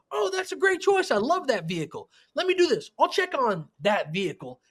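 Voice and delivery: bright, eager pitch jumps on the compliments; enthusiastic salesperson. Shown as a demonstration. Salesman on the phone to a caller, eager and upbeat. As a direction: gush over the customer's pick. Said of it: "So if I go".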